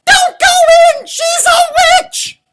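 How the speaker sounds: shrieking high warning cry; chicken's shout. A narrator's reading. Little chicken bursts into the story, squeaky shout. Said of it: "and she said".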